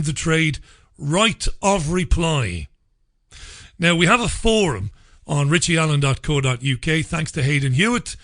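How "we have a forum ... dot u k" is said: ordinary talk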